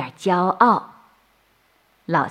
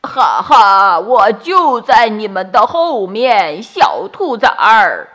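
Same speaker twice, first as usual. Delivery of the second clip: loud taunting wolf laugh and voice; menacing wolf; 2 semitones higher, 8 dB louder than usual. A narrator's reading. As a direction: loom over the bunnies as the big bad wolf. Wolf reveals himself. (野狼大声恐吓)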